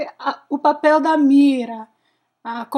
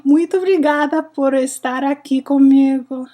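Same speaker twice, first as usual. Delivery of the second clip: usual speaking voice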